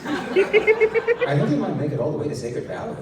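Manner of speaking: loud, high, broken by laughter; laughing through line. Real, not acted. Yaren remarking on Ethan's flight, reader laughing. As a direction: laugh while saying it.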